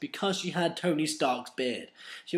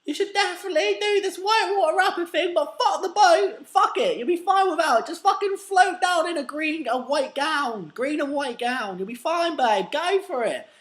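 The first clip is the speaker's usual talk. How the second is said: much higher, louder gushing voice; Kardashian impression; 7 dB louder than usual. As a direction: gush advice like a pushy PR girl. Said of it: "the Kardashian was like".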